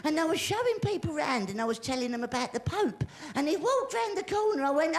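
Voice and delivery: ordinary talk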